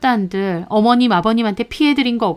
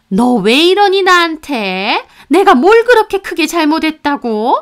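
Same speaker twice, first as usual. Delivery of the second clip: mother-in-law's indignant voice; 7 semitones higher, 5 dB louder than usual. Acted. Mother-in-law complaining to her son's wife, pitched-up indignant voice.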